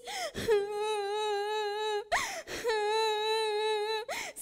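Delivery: put-on high childish wailing sob; crying. A narrator's reading. Mono, tired of searching, bursts into wailing cries. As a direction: bawl like a small boy. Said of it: "akhirnya Mono menangis".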